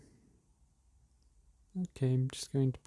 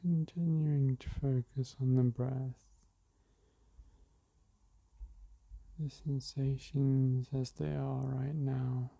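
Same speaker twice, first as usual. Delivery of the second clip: guided meditation; slower than usual. Acted. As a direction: ease listeners into the breath gently.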